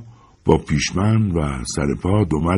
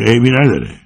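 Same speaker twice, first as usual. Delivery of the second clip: mocking repeat; 5 semitones higher, 3 dB louder than usual. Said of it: "میگه".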